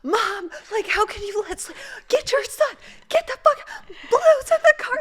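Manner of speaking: very high-pitched frantic yelling to mom; retold panicked scream. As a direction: re-enact screaming for help in the road, voice shooting up. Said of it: "I'm screaming in the middle of the road".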